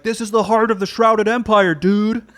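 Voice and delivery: high, loud crowd hype; hyped showman. Acted. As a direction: pump up the crowd. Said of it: "You're like".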